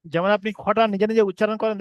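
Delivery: ordinary talk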